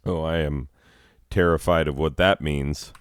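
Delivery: normal talking voice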